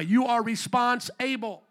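You are response-able, split into response and able; slow, with able set apart; stressed response-able. Shown as a demonstration. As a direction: lean on response-able, slowly. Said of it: "some of you never thought of it that way".

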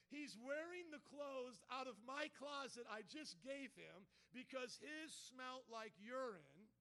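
loud, drawn-out preacher's indignation; emphatic preaching. Real, not acted. Pastor tells church whose clothes the man wore, preaching emphatically. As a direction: stretch each item out with mounting force.

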